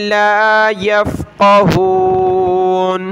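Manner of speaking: drawn-out ला यफ़क़हून; slow recitation. Shown as a demonstration. Teacher recites the phrase slowly. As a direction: chant it slowly.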